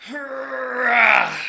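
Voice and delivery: high, loud waking roar; growling stretch shout. Retold or imitated. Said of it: "he'd be like".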